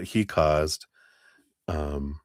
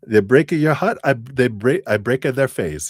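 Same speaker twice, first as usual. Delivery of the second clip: put-on playful Italian accent, higher and louder; tough-guy Italian accent, raised pitch; 6 semitones higher, 4 dB louder than usual. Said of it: "he's, like, saying it in an Italian accent".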